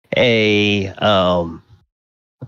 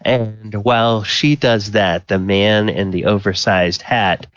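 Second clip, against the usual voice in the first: ordinary talk, nothing marked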